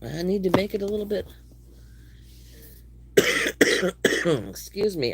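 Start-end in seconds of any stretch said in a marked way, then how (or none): 3.2-4.4 a real wheezy allergy cough. Real, not acted. Streamer coughing from allergies, wheezy cough. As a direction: cough through the wheeze, then carry on. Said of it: "I'm wheezing".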